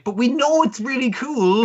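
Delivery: a hint of smug narrator; mock show voice. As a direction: voice the show patting itself on the back. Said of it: "It's like".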